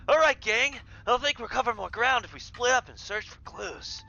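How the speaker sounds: high cartoon voice. GM plays high flirty character.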